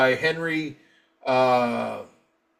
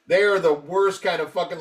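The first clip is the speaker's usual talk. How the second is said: ordinary talk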